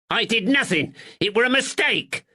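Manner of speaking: high, pleading northern shopkeeper; cornered man whining his innocence; frightened draper. Acted. Nervous draper pleads with the constable in a high voice.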